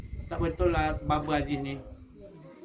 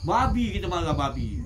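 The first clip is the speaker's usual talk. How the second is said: normal talking voice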